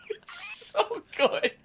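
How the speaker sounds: high squealing laughter through the words; laughing hard. Real, not acted. Friend recalling the sketch, helpless with laughter. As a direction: crack up, barely get it out.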